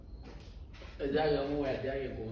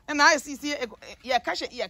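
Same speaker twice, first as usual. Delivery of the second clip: ordinary delivery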